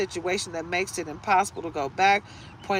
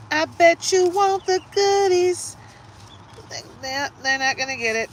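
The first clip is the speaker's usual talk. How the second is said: mocking tease; 12 semitones higher, 5 dB louder than usual. Teasing an ex who wants more.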